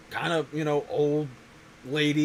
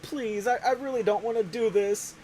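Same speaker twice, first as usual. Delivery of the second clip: mock pleading; 7 semitones higher than usual. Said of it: "she's, like, crying".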